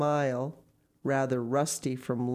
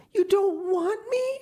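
put-on much higher, louder, desperate child; child's cry; 6 dB louder than usual. A narrator's reading. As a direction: plead like a hurt little girl. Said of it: "she cried".

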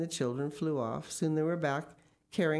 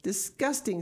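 higher-pitched exclamation of disgust; appalled rejection; 6 semitones higher than usual. Acted. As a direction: spit it out, revolted. Father rejects the worm burgers.

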